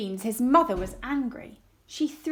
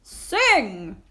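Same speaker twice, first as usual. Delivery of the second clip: louder booming giant order; giant command; 7 dB louder than usual. Acted. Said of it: "said the giant".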